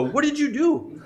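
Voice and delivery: high, laughing demand; laughing imitation of a gullible dad; mock accusation. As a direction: storm in blaming the other parent. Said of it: "and walk in and go".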